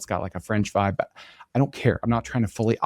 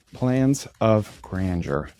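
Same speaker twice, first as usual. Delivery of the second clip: slow typing dictation; much slower than usual. Shown as a demonstration. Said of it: "Search for your next domain".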